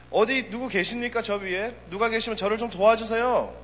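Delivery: high, loud call upward; calling for help. Retold or imitated. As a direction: cry out to heaven while hanging on. Interpreter voices man yelling.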